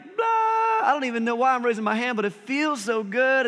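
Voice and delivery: overdone worship outburst; mock worship shout. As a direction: hands up, hammed-up bliss. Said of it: "You're like".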